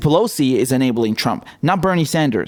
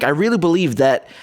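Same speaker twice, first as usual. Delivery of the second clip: normal talking voice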